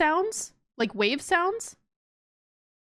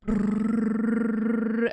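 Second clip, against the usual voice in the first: boat engine hum. Acted. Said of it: "you're out on a boat".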